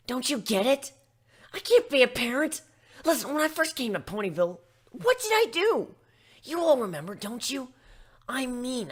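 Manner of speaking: a griffon frantically listing her own faults; upset outburst. Acted. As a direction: pour out your panic about parenthood. Gilda panics about motherhood.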